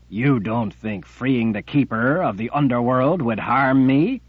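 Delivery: loud, rising accusing rebuke; furious tyrant. Acted. Jagang rages at sisters.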